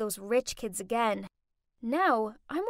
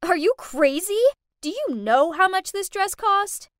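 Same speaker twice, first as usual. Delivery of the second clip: high, loud outraged yell; screaming; 6 semitones higher, 8 dB louder than usual. A narrator's reading. Rich girl raging at the maid over her dress, screaming. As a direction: yell in outrage, well above normal pitch. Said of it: "She pushed my mom and screamed".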